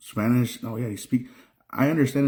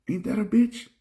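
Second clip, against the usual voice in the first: wry outburst; 10 semitones higher than usual. Real, not acted. Ironic outburst on being foreign everywhere.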